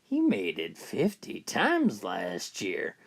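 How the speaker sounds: hint to the husband, slowly and pointedly; slow, low suggestive nudge at the husband; suggestive echo. Retold or imitated. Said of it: "smiled, and said".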